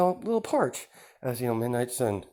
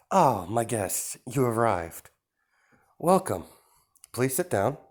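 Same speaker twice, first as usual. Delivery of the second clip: smooth villain host; 2 semitones higher than usual. Acted. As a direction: greet the guests with false charm, pitched up. Host villain greets guests, higher.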